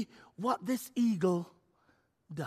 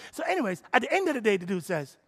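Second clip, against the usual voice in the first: ordinary talk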